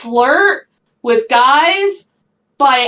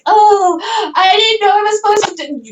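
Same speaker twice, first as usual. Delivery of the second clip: high, quick imitation of a feeble excuse; mock innocence; 6 semitones higher than usual. Imitating the coworker she chewed out, mock-innocent and high. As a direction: play dumb in a mocking higher voice.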